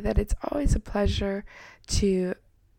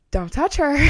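a sharp, protective warning, somewhat louder; angry warning; 6 semitones higher, 4 dB louder than usual. Retold or imitated. Mother warning someone away from her daughter, angry. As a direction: snap it like a mother shielding her child. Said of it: "In, in anger".

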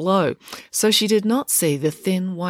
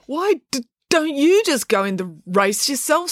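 high, stammered question; stammering; 9 semitones higher than usual. A narrator's reading. Joe challenging April to race herself, stammering. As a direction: catch on the d, asking high. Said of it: "You may stammer".

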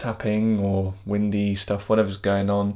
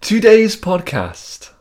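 announcer voice; 11 semitones higher, 9 dB louder, much slower than usual. Pretend. Grand announcement of topic.

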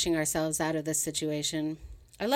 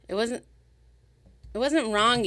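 emphatic; 5 semitones higher, 4 dB louder than usual. Acted. Drunk woman defending past love.

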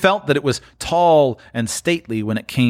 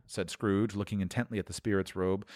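ordinary delivery, nothing marked

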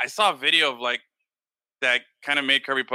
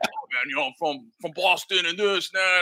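lightly imitated Boston guy; a hint of an odd-voiced hardcore fan; weird voice; 3 semitones higher than usual. Supervisor going on about Boston, lightly imitated weird voice. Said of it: "he had this weird voice".

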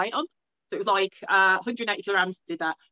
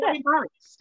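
higher, retold mortified outburst; embarrassed exclamation; 7 semitones higher, 4 dB louder than usual. Athlete recalling a teammate mopping up for her, embarrassed. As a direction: relive the embarrassment, pitched up.

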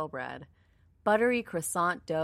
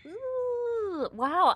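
surprised ooh. Real, not acted. Disappointed reaction after tasting.

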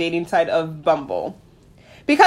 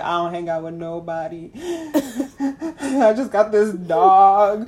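mock sad lonely; 2 semitones lower, slower than usual. Friend teasing her own loneliness, mock forlorn. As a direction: moan it like a hermit.

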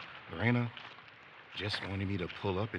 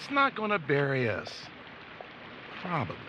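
louder, higher uneasy insistence; nervous reassurance; 5 semitones higher, 5 dB louder than usual. Acted. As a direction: reassure while unsure.

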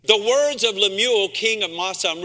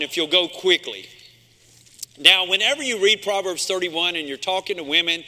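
ordinary talk, nothing marked

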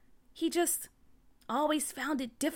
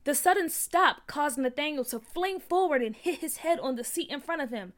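ordinary delivery

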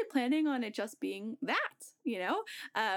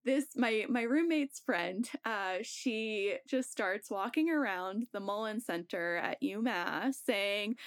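usual speaking voice

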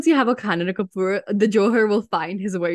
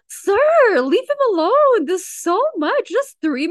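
high, loud pleading protest; exasperated plea; 10 semitones higher, 4 dB louder than usual. Real, not acted. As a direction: beg him to stop. Mock pleading with host.

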